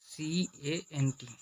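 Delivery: সিএএনটি spelled letter by letter, slowly and soft; spelling out. Shown as a demonstration. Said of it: "সম্পূর্ণ উচ্চারণ করে cosent".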